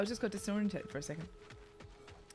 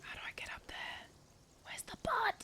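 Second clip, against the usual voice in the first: soft unvoiced whisper to himself; 11 dB quieter, faster than usual. Real, not acted. Player muttering while searching for the root, whispering. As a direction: whisper as if sneaking around.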